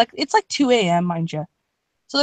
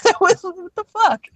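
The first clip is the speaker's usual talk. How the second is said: laughing; 9 semitones higher than usual. Real, not acted. Laughing at own past.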